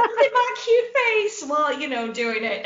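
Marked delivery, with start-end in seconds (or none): 0.0-1.4 very high, put-on cutesy voice; mock cutesy showing-off. Acting out a flirt fiddling with the camera, mock cutesy. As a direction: play up the cuteness in a squeaky pitch.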